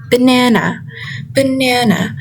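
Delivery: banana with na stressed. Shown as a demonstration. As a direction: say banana, hitting na. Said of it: "stress falls on the second syllable".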